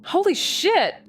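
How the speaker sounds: holy shit, high and startled; a sudden gasp of recognition; shocked exclamation. Acted. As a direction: pitch jumps in shock. Recognises the uncle's voice.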